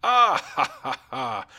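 high cruel cackle; mocking king laugh. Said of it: "Knowing full well ... he said".

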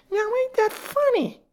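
very high, shrilled question; shrill it out as the fat woman. A narrator's reading. Fat woman startled by the unanswered bell, shrill. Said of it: "shrilled the fat woman".

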